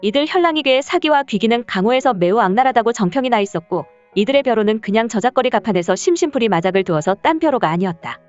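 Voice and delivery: ordinary delivery, nothing marked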